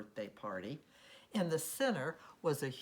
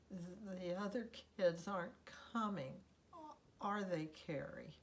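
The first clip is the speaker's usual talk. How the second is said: mildly trembling, higher little voice; trembling, soft; 5 semitones higher, 3 dB quieter than usual. A narrator's reading. Della choking back a sob to Carrie, trembling softly. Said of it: "Her voice trembling, Della softly said".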